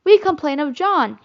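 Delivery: loud tattling complaint; cried out. A narrator's reading. Twins crying out a complaint about John. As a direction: call it out to tell on him. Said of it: "cried the twins".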